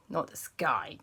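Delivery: usual speaking voice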